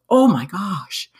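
imitating alarm. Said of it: "the look of terror on his face".

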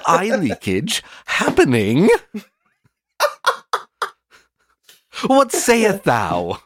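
high, slow theatrical jester; hammy stage actor. Pretend. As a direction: ham it up like a court minstrel. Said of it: "a jester costume or a minstrel costume".

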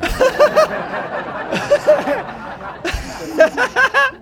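long high-pitched cackle; real laughter. Real, not acted. Laughing at silly idea.

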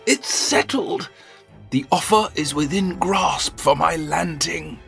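put-on high, soft, overjoyed old master; a thrilled old father; overjoyed old man.

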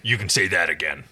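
low, lightly voiced muttering character; a low grumbled agreement; muttering Dargo. A narrator's reading. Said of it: "Dargo muttered".

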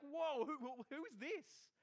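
loud, high, stammering shock; acted awe at a booming voice; freaked out. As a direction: stumble over the question, startled. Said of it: "and you're freaked out".

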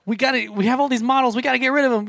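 high, loud pushy car salesman. Pretend. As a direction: hype up the big sale. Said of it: "doing a car ad".

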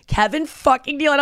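excited greeting. Retold or imitated. Daughter spots her comedian friend backstage, excited. Voicing own delight to friend.

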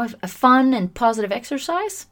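usual speaking voice

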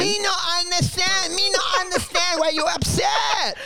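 high, shouted broken English voice; broken English put-on. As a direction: play dumb in broken English.

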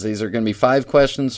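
usual speaking voice

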